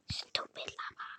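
hushed irritated grumble; whispered grumble. Real, not acted. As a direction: whisper it in annoyance. Muttering at lava.

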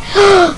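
high, sharp gasp; short high-pitched gasp of alarm; panicked gasp. Acted. Panicking over dying in game.

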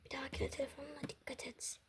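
fast unvoiced whispered telling-off; whispered scolding. Mother whispering a quick scolding to her daughter. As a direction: scold her under your breath.

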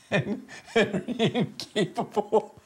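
cracking up mid-sentence; laughing. Real, not acted. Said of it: "I don't even think you could just end on laughing".

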